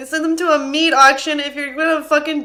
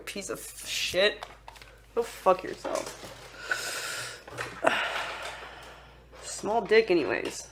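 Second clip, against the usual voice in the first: whispered insults, softer; muttered venom under the breath; bitter whisper; 4 semitones lower, 12 dB quieter than usual. Real, not acted. Insults muttered away from mic.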